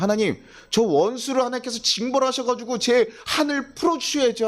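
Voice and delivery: aggrieved believer crying out to God; pleading for revenge. Retold or imitated. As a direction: demand God punish the enemy.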